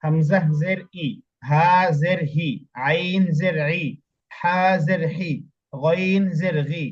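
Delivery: slow, clear, each syllable spelled out; slow syllable drill. Shown as a demonstration. Teacher modelling Arabic letters for a student, slow and clear. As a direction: model every sound slowly for the student. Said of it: "দেখেন".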